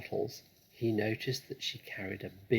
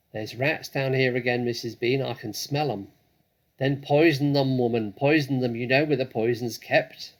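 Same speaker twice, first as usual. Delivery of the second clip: louder calls between floors; loud calling; 3 semitones higher, 10 dB louder than usual. Acted. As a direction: shout it through the house. Mabel and Mrs Bean calling.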